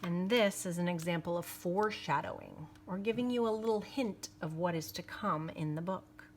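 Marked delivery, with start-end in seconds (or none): none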